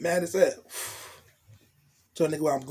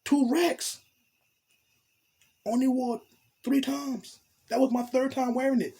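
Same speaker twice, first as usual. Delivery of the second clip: indignant; 8 semitones higher than usual. Real, not acted. Outraged about the lost coat.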